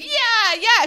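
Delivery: high, loud whooping yeah; retold ecstatic cheer. Retold wild cheer while whipped.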